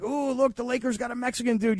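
a hint of a thrilled sports fan; excited fan. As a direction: toss it off like an eager fan. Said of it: "everybody who's, like, gets so excited".

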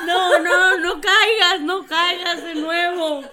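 loud, laughing "don't fall for it"; slow, loud teasing warning to a friend; laughing protest. Real, not acted. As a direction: shout a warning through laughter. Friend stops her taking cookies.

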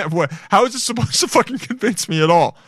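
high-pitched, exasperated disbelief; incredulous retort. Retold or imitated. Streamer reacting to the video's flimsy claim, high and incredulous. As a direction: protest it in baffled disbelief, pitch jumping up. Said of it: "And I'm like".

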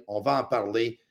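ordinary delivery

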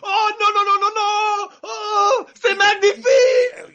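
high, shouting French exclamations; screaming with French delight; French chef. Retold or imitated. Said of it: "the French broadcast".

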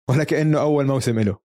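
normal talking voice

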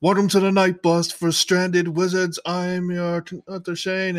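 welcoming Harry aboard in a lively cheeky voice; Stan's voice. Retold or imitated. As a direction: announce it like a cheeky bus conductor. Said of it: "He's like".

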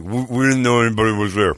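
muffled, food-stuffed mumbling; mouth full. Retold or imitated. Answering an accusing lady while eating, mouth full. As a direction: imitate talking round a mouthful of food. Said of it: "Mouthful of food".

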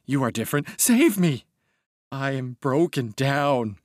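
high, desperate begging that swells in bursts; anguished pleading. A narrator's reading. Broken man begs Mister Castaigne for help, anguished pleading. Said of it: "crying and gasping".

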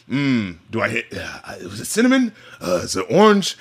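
lightly voiced perfumer musing over a scent; sniffing perfumer. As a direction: ponder each note aloud, sniffing. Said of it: "he's like".

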